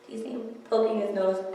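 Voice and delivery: ordinary delivery, nothing marked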